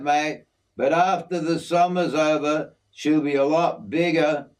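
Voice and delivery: easygoing outback mate showing off his aviary; hint of a broad Australian working man; Aussie bloke Jim. Jim talks plans.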